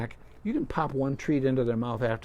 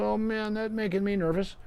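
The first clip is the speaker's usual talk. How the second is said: dog's nervous thought; 8 semitones higher than usual. Retold or imitated. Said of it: "and the dog goes".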